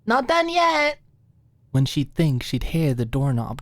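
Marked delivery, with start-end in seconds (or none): 0.1-1.0 high, bright call through a door; high-voiced girl calling out not to come in yet; Maud calling out. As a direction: shout a warning from the other room, playful. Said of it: "Maud would shout a little".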